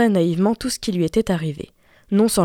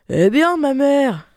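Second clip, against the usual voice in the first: slow, raised, grudging answer; surly reply; 6 semitones higher, 4 dB louder, slower than usual. A narrator's reading. Rude daughter answering her mother, surly. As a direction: drag it out sullenly. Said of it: "lui répondit la brutale".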